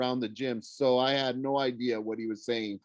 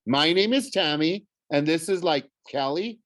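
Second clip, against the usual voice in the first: higher, louder cheerleader introduction; bubbly; 5 semitones higher, 4 dB louder than usual. A narrator's reading. Cheerleader introducing herself to the freshmen, bubbly. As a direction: introduce the squad with peppy, high-pitched bounce. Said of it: "bubbled in, uh, into the microphone".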